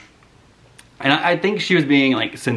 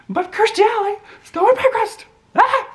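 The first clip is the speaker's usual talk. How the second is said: much higher, squealing indignant delivery; mock outrage; 4 dB louder than usual. Real, not acted. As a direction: relive the theft with playful fury.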